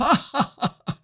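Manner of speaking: a very high, airy put-on giggle; imitating the teacher's high laugh; mock high giggle. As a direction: trill a silly laugh way up high.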